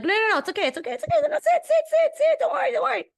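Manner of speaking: high, hammed-up mother insisting; high shrill-free mock mom brushing off help; imitating Israeli mom. Son offers help, mother waves him off in a high mock voice. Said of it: "And she's like".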